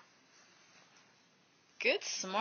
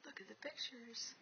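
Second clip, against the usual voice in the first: whisper; 24 dB quieter than usual. Real, not acted. Quiet prompt to toddler.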